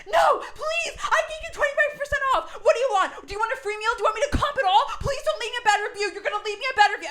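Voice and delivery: high, loud desperate begging; frantic pleading. Retold or imitated. Restaurant owner begging diners not to post a bad review, frantic. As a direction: beg them frantically, voice up high.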